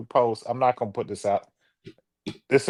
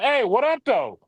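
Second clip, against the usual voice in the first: higher-pitched challenge that drops at the end; mock provocation, pitched up on "up"; confrontational greeting; 9 semitones higher than usual. Shown as a demonstration. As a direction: greet someone you dislike, looking for trouble.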